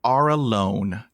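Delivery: ordinary delivery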